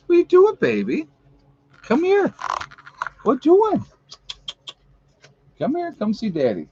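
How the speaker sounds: very high, cooing pet voice; squeaky sing-song coaxing to a pet. Real, not acted. Owner coaxing the dog to come see Daddy, baby talk.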